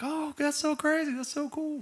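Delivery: high, breathy-free falsetto gush of an impressed parent; mock-impressed parent voice. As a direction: squeal it like an amazed mom. Parents gushing at Halloween display.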